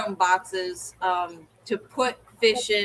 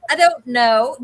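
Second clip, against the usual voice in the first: exasperated retort; 3 semitones higher, 8 dB louder than usual. Retold or imitated.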